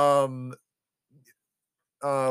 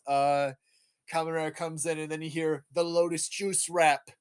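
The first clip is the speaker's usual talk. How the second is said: ordinary talk, nothing marked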